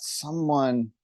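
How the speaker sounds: ordinary talk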